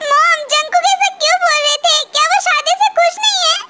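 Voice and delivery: high, tearful question with a sob in it; crying. Acted. Wife asking if her husband is unhappy, crying. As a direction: plead through tears. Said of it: "पहले तो तुम रोना बंद करो".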